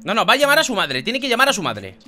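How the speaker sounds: normal talking voice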